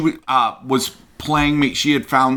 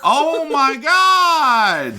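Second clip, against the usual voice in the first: real stunned shout; 12 semitones higher, much slower than usual. Real, not acted. Reacting to the Dances with Wolves twist, stunned shout. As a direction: cry out in disbelief.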